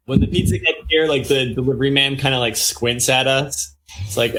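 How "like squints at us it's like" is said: ordinary talk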